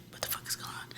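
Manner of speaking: hushed repeat of the stunned question; whispered shock. Retold or imitated. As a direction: whisper it again, still in disbelief. Repeats the shocked retold quote.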